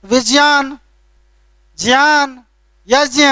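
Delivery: विज्ञान, ज्ञान, यज्ञ with ज्ञ said as "jya"; a careful j-plus-y in विज्ञान, ज्ञान, यज्ञ, word by word; correct pronunciation. Shown as a demonstration. Teacher modelling the proper sound for students, careful jya in each word. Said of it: "ये सही उच्चारण है".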